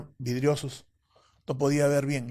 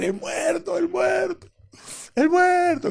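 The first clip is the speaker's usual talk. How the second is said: high, loud terrified shrieks; panicked scream; 5 dB louder, slower than usual. Retold or imitated. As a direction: scream it like you've seen a ghost. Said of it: "comenzó a gritar".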